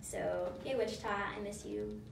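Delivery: ordinary talk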